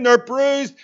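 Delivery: ordinary talk, nothing marked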